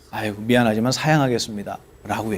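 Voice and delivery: a sneering "no thanks", said low and soft; scoffing refusal. Shown as a demonstration. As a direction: brush them off with a quiet sneer. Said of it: "이렇게 비웃어요".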